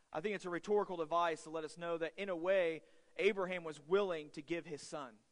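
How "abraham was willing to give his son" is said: normal talking voice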